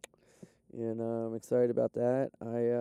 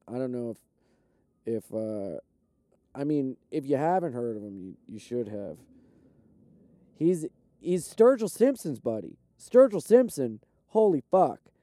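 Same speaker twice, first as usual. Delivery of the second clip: ordinary talk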